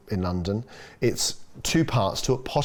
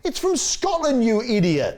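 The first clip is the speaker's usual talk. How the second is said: high, slow, scornful put-down, much higher; exasperated mock retort; 13 semitones higher, slower than usual. Chef scolding buyers over French-labelled langoustines, exasperated high put-down. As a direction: scoff at them like idiots.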